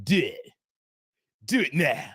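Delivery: mildly menacing emperor order; Dark Sidious. Retold or imitated. Streamer imitating the Star Wars villain, mildly sinister. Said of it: "Dark Sidious voice".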